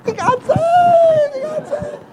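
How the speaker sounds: very high, slow, loud imitated cry of joy; imitating mother's joyful shout; ecstatic announcement.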